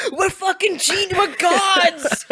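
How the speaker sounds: hammed-up victory shout; mock triumphant shout. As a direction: yell it like a swollen-headed champion. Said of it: "we're gonna be like".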